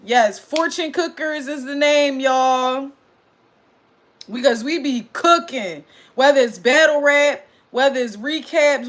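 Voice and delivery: ordinary delivery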